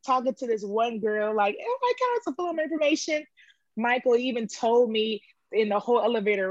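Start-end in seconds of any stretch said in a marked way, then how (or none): 1.6-3.2 excited chatter. Retold or imitated. Mimicking a chat with a girl.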